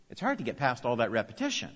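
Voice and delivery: ordinary talk, nothing marked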